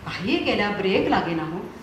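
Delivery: normal talking voice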